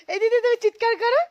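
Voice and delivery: high, loud hilltop shout. Real, not acted. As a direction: call out across the slope.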